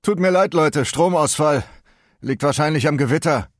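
cinema owner. Acted. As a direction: call out an apology to an angry crowd. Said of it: "Der Kinobesitzer stellte sich vor das schimpfende Publikum und sagte".

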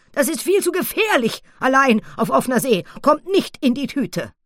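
high, loud scolding granny; a grandmother forbidding it flatly; stern jungle granny. Acted. (Urwaldoma, streng, hoch und laut)

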